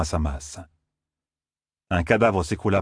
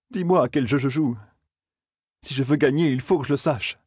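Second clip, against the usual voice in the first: a higher, strained plea; Matt's prayer; 6 semitones higher than usual. A narrator's reading. Matt on his knees, pleading. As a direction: beg the Light, shaken.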